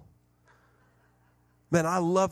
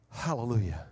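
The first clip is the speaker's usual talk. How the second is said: mock hallelujah; 4 semitones lower, 5 dB quieter than usual. Joking praise about the drink.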